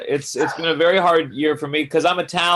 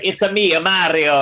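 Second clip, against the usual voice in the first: cartoonish Italian accent, a little raised; Mario; 2 semitones higher than usual. Comic being a smart ass to his critic, cartoon Italian accent. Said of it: "gonna do that accent".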